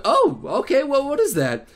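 ordinary talk

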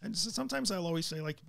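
normal talking voice

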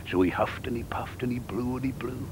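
soft whispered huffing. A narrator's reading. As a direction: breathe the words out in a hush.

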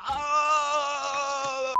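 high drawn-out "ah"; sustained nervous yell; long wail. Pretend. Redneck nerve attack.

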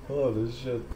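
ordinary talk, nothing marked

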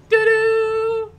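high, loud doo-doo jingle; playful imitation of a stock cue; mock sound effect. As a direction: toot it like a cheap game sound. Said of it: "stock sound effect of".

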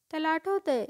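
excited cry. A narrator's reading. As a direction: cry it out, thrilled to be remembered. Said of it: "डिडलस डिगल ओरडलाच".

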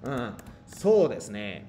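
slow, drawn-out "Ah, so desu ne"; slow model phrase. Shown as a demonstration. Teacher presenting the new phrase to students, slow. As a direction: lay the phrase out slowly to teach it. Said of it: "aprovechamos esta palabra".

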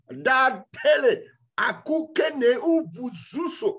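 high, slow ecstatic tongues; speaking in tongues. Real, not acted. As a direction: pour out tongues in rising prayer. Preacher breaks into tongues.

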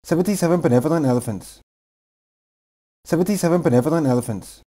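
rattled off at top speed; very fast. Shown as a demonstration. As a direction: blur the tongue twister together. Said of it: "Say the tongue twister faster and faster and faster".

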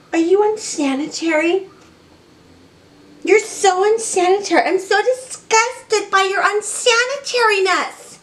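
high, loud teasing pet voice. Real, not acted. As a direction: coo and tease the pets. Teasing pets in baby talk.

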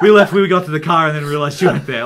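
acted-out loud parental explanation; imitated frantic dad. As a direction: blurt it out as a flustered dad. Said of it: "he's like".

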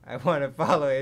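amused, giggling repeat; laughing. Real, not acted. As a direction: crack up on the repeat. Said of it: "repeat with laugh".

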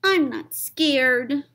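put-on slow, high, defiant child voice; stubborn high little kid; brave little child. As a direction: insist bravely, slowly.

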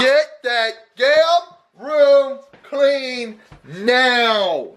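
drawn-out stern command; slow stern order. Acted. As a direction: stretch every word, very stern. Dad orders room cleaned.

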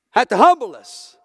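preacher repeating the point at full volume; loud preaching repeat. Real, not acted. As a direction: drive it home with full force. Repeats the line, loud then hushed.

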